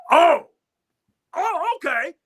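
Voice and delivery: high, punched "oh" of mock offence; imitated taken-aback reaction. Guitarist told his solo falls short, imitated taken-aback reaction. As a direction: take the challenge with a high jolt.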